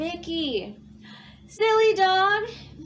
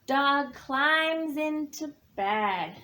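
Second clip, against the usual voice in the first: ordinary delivery, nothing marked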